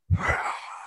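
big decompressing sigh; acted heavy, voiceless sigh; long exhale. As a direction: breathe it all out to calm down. Acts parent calming down alone.

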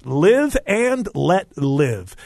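each word spaced out; slow and deliberate; slow emphatic. Real, not acted.

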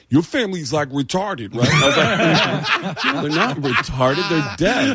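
comic quoting Tracy Morgan about his deaf family; Tracy Morgan impression. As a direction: do Tracy Morgan asking the dumb question. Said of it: "Tracy Morgan saying to me".

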